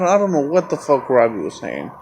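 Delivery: normal talking voice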